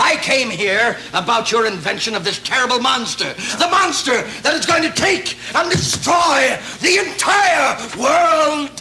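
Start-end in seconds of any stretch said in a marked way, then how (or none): 3.6-8.7 ranting shout. Acted. Visitor ranting about the terrible monster, shouting high. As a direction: rant about the monster like a ham actor. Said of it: "He gets this way every year".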